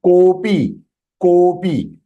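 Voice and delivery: kopi with a long, held "ko"; drawn-out first syllable. Shown as a demonstration. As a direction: stretch the "ko" of kopi by one breath. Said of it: "has to be extended by one breath".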